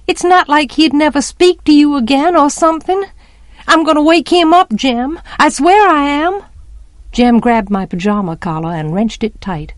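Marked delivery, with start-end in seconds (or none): 0.1-6.4 put-on high, insistent child's threat; urgent pleading. A narrator's reading. Child threatening to wake Atticus, urgent and high. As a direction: insist urgently, voice pitched well up.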